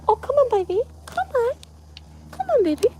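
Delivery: high cooing come-on to a pet; coaxing pet talk. Real, not acted. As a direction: coax the dog sweetly. Coaxing her pet for a kiss.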